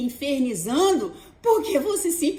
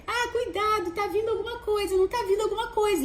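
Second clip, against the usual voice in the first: high, quick fretful warning; anxious inner voice; 8 semitones higher, faster than usual. Retold or imitated. Inner voice warning of danger, high and jittery. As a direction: fret in a high hurried voice. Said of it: "E aí vai aquilo ali".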